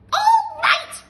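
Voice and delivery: slow, very high, loud screech; panicked shout. Acted. Grandma burning inside, screeching shout. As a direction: shriek for help. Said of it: "she yelled".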